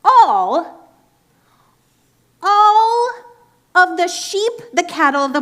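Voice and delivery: loud, high, emphatic proclamation; dramatic loud reading. Acted. Dramatic retelling of Jesus driving out sellers, loud and high. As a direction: boom out the scripture with force.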